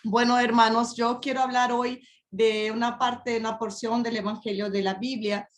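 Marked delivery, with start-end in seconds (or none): none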